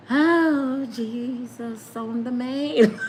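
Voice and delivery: high, drawn-out cry with a laugh; overwhelmed swooning. Retold or imitated. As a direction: swoon over your crush calling. Overwhelmed by crush's phone call.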